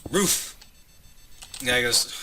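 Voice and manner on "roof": high, punchy bark-like "roof"; dog yelping a word; talking dog bark. Acted. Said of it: "different takes on barking".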